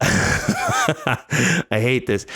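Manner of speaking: laughing it out slowly. Real, not acted. Comedian laughing at his own counting mess. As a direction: groan through a laugh at himself.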